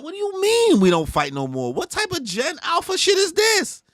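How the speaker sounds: rising into a very high squeal; baffled kid squealing at the idea; squeaky outraged kid. Retold or imitated. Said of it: "They said".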